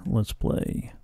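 breathy creepy whisper. Acted. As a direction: whisper it like a ghost child. Voicing creepy game enemy.

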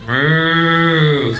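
loud, long imitated moo; mooing cow. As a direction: bellow like an arrogant cow. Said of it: "A couple of cows are getting arrogant".

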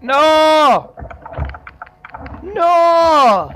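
loud, high cry of no; dismayed shout. Real, not acted. Driver yelling as his truck gets stuck. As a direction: yell in real dismay.

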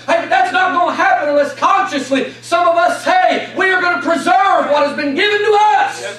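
fiery revival preacher in full cry; preaching at full force. Acted. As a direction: rouse the congregation with a climbing shout.

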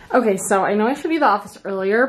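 normal talking voice

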